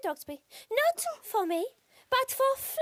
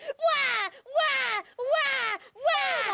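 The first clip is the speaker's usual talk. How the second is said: put-on baby crying wah; 5 semitones higher, much slower than usual. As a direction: wail like a noisy baby. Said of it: "babies are really noisy, aren't they? Like this".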